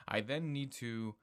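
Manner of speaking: usual speaking voice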